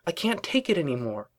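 high, open exasperation; exasperated outburst. A narrator's reading. Twilight unburdens herself to Rarity, openly exasperated. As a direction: let the frustration out at full voice. Outburst after being misunderstood.

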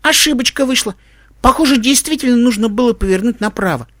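put-on high child's voice owning up; sheepish boy. Tim admits he chose wrongly, high sheepish voice.

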